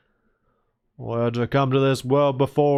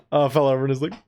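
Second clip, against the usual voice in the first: ordinary talk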